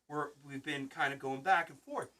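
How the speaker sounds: ordinary talk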